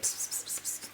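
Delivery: hushed unvoiced "pss" sounds; soft hissed gossip noise; imitated gossip whispering. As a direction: mimic classmates whispering behind a back. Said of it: "talking about it".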